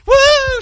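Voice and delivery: very high, loud whoop; excited whoop. Retold or imitated. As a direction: imitate a wife whooping over great news. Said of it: "she started shouting".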